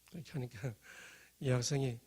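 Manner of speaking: ordinary talk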